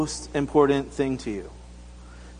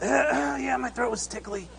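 put-on small, high child voice feigning a sore throat; Taryn playing sick; 6 semitones higher, 7 dB quieter than usual. Daughter backing up dad's excuse, pretending to be sick. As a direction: put on a weak little cough, then high and soft. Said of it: "Taryn's like".

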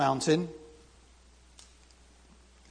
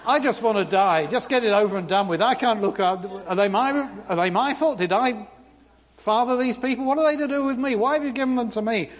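exasperated, raised complaint at God, pushing on; fed up Moses; 5 semitones higher, 3 dB louder than usual. Retold or imitated. Moses complaining to God, fed up and exasperated. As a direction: vent it all out like a worn-out leader. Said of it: "he was really fed up".

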